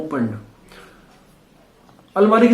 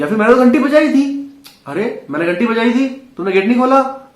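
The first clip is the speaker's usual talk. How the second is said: higher, louder mild complaint; mock protest; 6 semitones higher, 4 dB louder than usual. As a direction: protest as if left waiting at the door. Acts out doorbell complaint in example.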